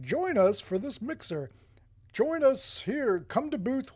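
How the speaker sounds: loud, high, drawn-out mock invite; mock eager invitation. Mimicking pushy event invitation emails.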